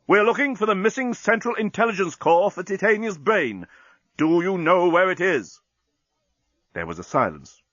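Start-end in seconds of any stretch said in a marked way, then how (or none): 0.1-5.6 insistent captain. A narrator's reading. Captain demanding the parrot answer, insistent.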